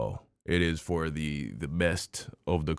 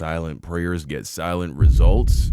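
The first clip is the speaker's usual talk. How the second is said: slow, louder disbelieving question rising at the end; incredulous repeat; 8 dB louder than usual. Real, not acted. Vlogger repeating a congresswoman's line in disbelief. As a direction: echo the quote as if you can't believe it.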